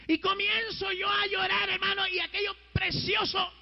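fervent preacher's testimony, pitched high; impassioned preaching. Real, not acted.

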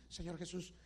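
low murmured prayer swelling to a loud cry; grateful prayer building up. Shown as a demonstration. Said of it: "¿quién oró esta mañana así? Así".

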